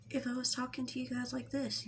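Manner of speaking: hushed, near-whispered calm voice. Shown as a demonstration. Vlogger showing viewers the manipulation tactic, in a hushed calm tone. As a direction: speak softly, almost whispering, as if perfectly calm. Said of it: "in a calm tone".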